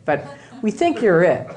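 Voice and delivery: ordinary delivery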